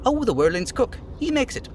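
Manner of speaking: a hint of a chirpy little dockhand; eager little worker; dock worker voice. Voicing a dock worker NPC.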